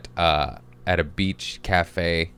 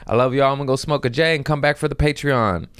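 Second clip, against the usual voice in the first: ordinary delivery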